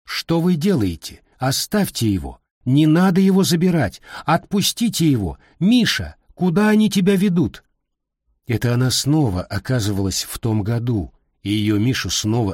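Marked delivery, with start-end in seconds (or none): 0.0-7.6 higher-pitched, pleading outcry, held short of a scream; retold desperate shouting. Grandmother on the phone reliving her son's arrest, retold desperate cries. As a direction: imitate grandmother crying out in alarm. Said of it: "Бабушка начинала кричать в трубку".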